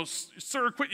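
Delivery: high, mock-cross customer service agent; retold mad agent. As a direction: act out an agent getting mad. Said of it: "she got mad at me".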